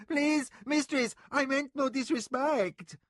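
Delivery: high, loud, slow begging; pleading servant. A narrator's reading. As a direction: beg forgiveness from the floor. Servant prostrate begging mistress.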